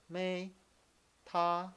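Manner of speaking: MAY and TA held high and level; match TA to the high, level MAY; first tone. Shown as a demonstration. Teacher copying the video's trick, MAY and TA high and level. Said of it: "you think of maybe".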